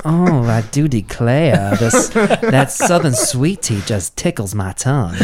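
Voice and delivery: put-on slow, drawn-out Southern belle accent, a bit laughing. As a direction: ham up a sweet Southern gentleman accent. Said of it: "South Carolina, like, accent".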